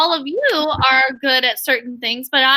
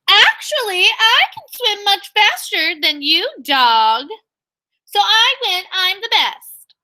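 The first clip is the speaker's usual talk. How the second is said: higher, bragging goose voice, drawn-out at the end; boastful goose; 6 semitones higher, slower than usual. Acted. Teacher voices the goose bragging to the dog, higher and boastful. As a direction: show off like a smug goose. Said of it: "Uh-oh, the goose said it too".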